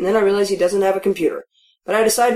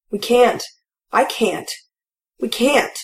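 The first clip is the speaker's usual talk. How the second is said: crying; 4 semitones higher, slower than usual. Acted. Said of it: "She's crying for real now".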